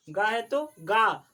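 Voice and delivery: गा said slowly and loud; forceful long aa. Shown as a demonstration. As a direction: stretch and push गा. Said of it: "उसको थोड़ा power से बोलोगे".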